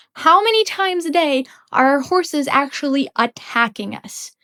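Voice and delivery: normal talking voice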